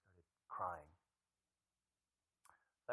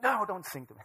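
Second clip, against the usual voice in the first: put-on higher, louder bratty little boy; mock kid refusal; 11 semitones higher, 13 dB louder than usual. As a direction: push the song away like a grumpy kid. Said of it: "He's like".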